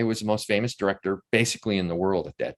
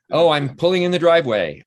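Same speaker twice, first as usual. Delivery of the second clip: louder, higher imitation of a caller; playful retold call, pitched up; joking phone announcement; 7 semitones higher, 7 dB louder than usual. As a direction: announce your arrival as a running joke.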